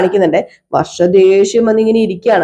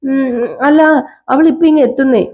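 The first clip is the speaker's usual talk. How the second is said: high voiced character; 7 semitones higher than usual. Character fobbing off a question.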